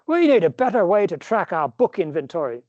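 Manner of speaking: an American's playful put-on British bookseller; lightly done British accent; mock posh British shopkeeper; fake British accent. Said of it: "practice my fake British accent".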